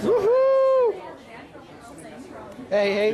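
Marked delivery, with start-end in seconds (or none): 0.0-1.0 high, loud whoop; cheering whoop. Real, not acted. Friend cheering the club banner, loud whoop. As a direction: let out a big celebratory whoop.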